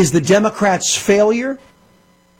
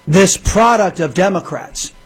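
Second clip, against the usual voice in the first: usual speaking voice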